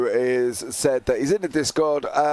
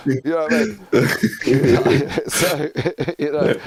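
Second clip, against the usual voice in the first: giggling through the words, real laughter; can't get the words out for laughing; 4 dB louder than usual. Real, not acted. Host giggling, can't say anything.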